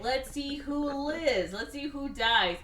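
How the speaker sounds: high, slow and mocking, a hammed-up movie villain; taunting villain. Villain Wesley taunting Dalton on the phone, hammed-up high mock voice. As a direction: savour each word like a gleeful bad guy. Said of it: "and he's like".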